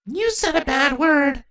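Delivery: put-on high, slow childish scold. A narrator's reading. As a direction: tell off like a little kid. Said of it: "Uvenis scolded".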